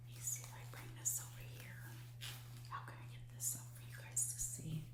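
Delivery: whispered aside, quick; hushed and fast while fumbling the camera. Real, not acted. Stitcher whispering to viewers while showing the threads.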